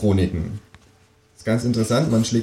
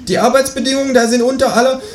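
high, chatty kangaroo voice; 3 dB louder than usual. A narrator's reading. Kangaroo character voice. (hohe, plaudernde Känguru-Stimme)

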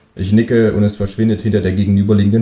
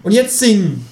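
much higher, cartoonish kangaroo voice; 12 semitones higher than usual. Kangaroo asking the narrator, in a much higher voice. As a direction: pitch it way up like a cheeky kangaroo.

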